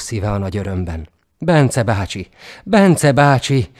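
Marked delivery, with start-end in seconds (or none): 1.4-2.3 ujjongás. A narrator's reading. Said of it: "újjongott Miklós". 2.6-3.7 hangosabb, elnyújtott, lassú örömkiáltás; kiáltsd nevét elnyújtva, örömmel; ujjongó kiáltás. A narrator's reading. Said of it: "újjongott Miklós".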